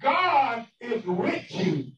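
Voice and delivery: very slow, deliberate promise; preacher voicing a mother's comfort, drawn out; retold solemn reassurance. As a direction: stretch every word out with conviction.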